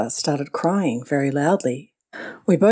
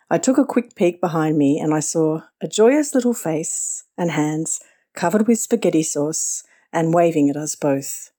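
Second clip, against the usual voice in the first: ordinary talk, nothing marked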